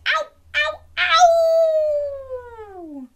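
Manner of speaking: two high yelps then a long wail; loud, very high play-along ow; pretend hurt howl. Storyteller showing children a hurt nose, pretend howling. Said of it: "pretend you've hurt your nose".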